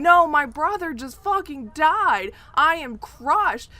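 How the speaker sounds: imitating Drake crushed by his brother's death; mock anguish. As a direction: overplay a brother's despair, slow and loud. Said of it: "with Drake going".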